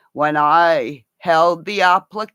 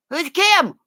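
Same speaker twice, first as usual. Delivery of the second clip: high mock question. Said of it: "imitated quote".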